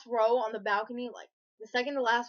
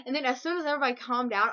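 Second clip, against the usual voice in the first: ordinary talk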